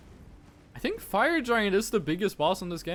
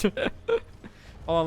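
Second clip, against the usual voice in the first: ordinary talk, nothing marked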